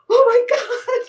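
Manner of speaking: high, loud, laughing shock; shocked gasp. Retold or imitated. Driver spotting a kid in the back seat, laughing shocked squeal. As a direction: squeal it out in disbelief.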